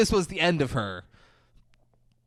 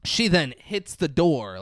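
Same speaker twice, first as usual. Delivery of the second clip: normal talking voice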